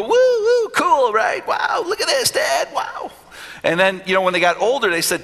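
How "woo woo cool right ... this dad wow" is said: put-on excited kids. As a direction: squeal it like a thrilled child showing Dad. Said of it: "they would hold it and go".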